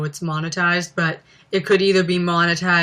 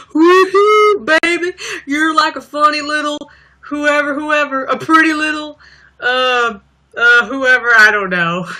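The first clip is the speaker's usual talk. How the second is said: higher, louder hammed-up country dad; 8 semitones higher, 5 dB louder than usual. Vlogger imitating the skit's dad singing to the crowd, hammed-up. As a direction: whoop it up like a goofy country singer dad. Imitating [name] in skit.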